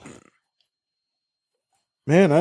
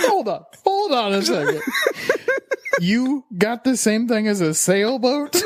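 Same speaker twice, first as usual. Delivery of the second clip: higher-pitched, incredulous laughing question; 10 semitones higher than usual. Real, not acted. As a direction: crack up while asking. Cracking up at friend's barnacle story.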